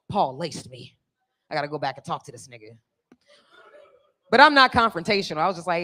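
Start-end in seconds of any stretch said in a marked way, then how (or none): 0.1-2.8 low, fast, alarmed; quick low worried rush to himself; low panicked mutter. Retold or imitated.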